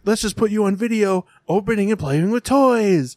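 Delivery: high-pitched, over-excited parent pitch; mock enthusiastic parent. Parent pitching toy videos to their kids, mock enthusiastic. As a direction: sell it in a high, peppy voice.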